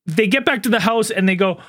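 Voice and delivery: ordinary talk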